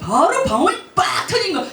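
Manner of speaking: high, loud, laughing with joy; excited burst. Real, not acted. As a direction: let the joy burst out as it finally breaks. Climax of tongues testimony. (기쁨에 웃으며 터져 나오는 높고 큰 목소리)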